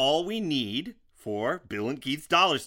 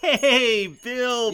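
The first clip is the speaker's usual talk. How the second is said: excited greeting; 7 semitones higher, 7 dB louder than usual. Acted.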